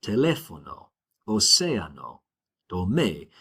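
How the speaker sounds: teléfono, océano, tomé stressed on LÉ, CÉ, MÉ; stress on accent mark. Shown as a demonstration. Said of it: "goes up where that accent mark is".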